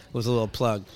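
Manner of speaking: ordinary delivery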